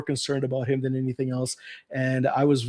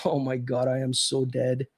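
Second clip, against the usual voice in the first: quiet, lightly voiced dread; hushed mock dread; 3 semitones lower, 5 dB quieter than usual. Boy dreading his mother, mock doomed under breath. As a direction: mutter the doom to yourself, half-joking. Kid dreading getting caught.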